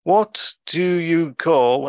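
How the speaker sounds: slow sloth. Retold or imitated. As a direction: imitate a sloth stretching out every word. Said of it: "the sloth turns to his cohort".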